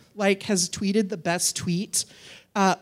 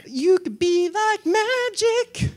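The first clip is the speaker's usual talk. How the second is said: high, slow, drawn-out sing-song; 11 semitones higher, 4 dB louder, much slower than usual. Shown as a demonstration. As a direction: chant it high as a playful demonstration. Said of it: "do it in a little sing-song voice".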